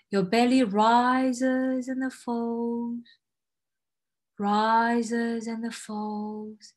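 stretched, unhurried guiding phrase; very slow. Acted. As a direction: draw each word out like a wave. Meditation, drawn out.

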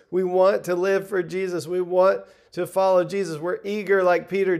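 ordinary talk

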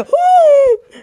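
very high, loud surprised "uh"; high loud mock gasp; shocked gasp. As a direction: catch your breath in scandalized shock. Reacting to seeing César.

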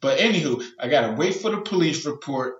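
normal talking voice

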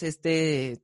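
ordinary delivery

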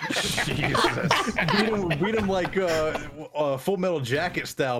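high, laughing mock threat; excited laughing. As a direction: hype it up through laughter.

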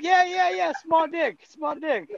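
high, loud mocking chatter; mock nail-salon worker. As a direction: play the gleeful tattoo artist laughing at him. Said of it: "They're like".

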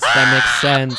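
loud emphatic repeat; shouted. Real, not acted.

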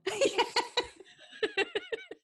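high burst of real laughter; big delighted laugh. Real, not acted. Laughing at the mum line.

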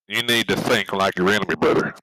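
usual speaking voice

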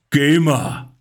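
low, drawn-out mock stage call; imitating a DJ's drawn-out catchphrase; imitated mic catchphrase. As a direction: draw it out like calling through a mic. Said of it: "der wird genauso Gamer schreien".

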